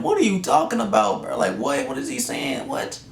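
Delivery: incredulous outburst. Retold or imitated. Retold outburst at the principal.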